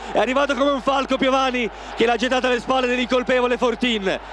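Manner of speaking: excited goal celebration. Real, not acted. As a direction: cheer the goal to the listeners. (commento euforico dopo il gol)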